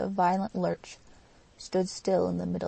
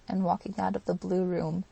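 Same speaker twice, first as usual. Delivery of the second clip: ordinary delivery, nothing marked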